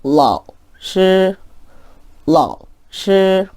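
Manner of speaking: 반삼성 러시 천천히; half third tone. Shown as a demonstration. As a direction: let 러 fall and pause before level 시. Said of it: "잘 들어보세요".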